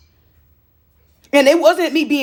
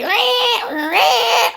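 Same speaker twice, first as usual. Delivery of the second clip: bird screech; 9 semitones higher than usual. Retold or imitated. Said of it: "Normally, he be".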